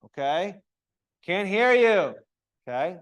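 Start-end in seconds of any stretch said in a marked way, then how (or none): 1.2-2.1 loud coaching call; louder, teacher calling out; calling for more volume. Shown as a demonstration. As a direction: project it to the back of the room. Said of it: "I'm gonna be coaching you louder".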